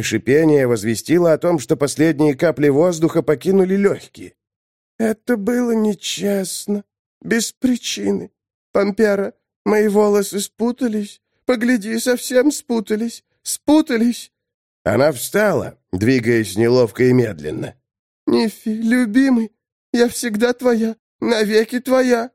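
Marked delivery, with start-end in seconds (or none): 5.0-14.3 put-on high, thin whining dead girl; high petulant undead complaint; whiny undead girl. A narrator's reading. 18.3-22.3 put-on high, thin whining dead girl; high petulant undead complaint; whiny undead girl. A narrator's reading. Dead girl pleading to lover.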